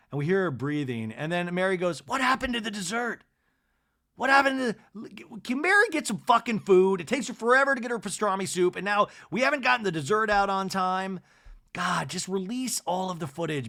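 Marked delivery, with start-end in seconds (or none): 2.1-11.2 high-pitched griping about service; exasperated, hammed-up demand in a raised voice; indignant complaint. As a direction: imitate a put-out diner, pitched well up. Acted Mary complaining about dessert.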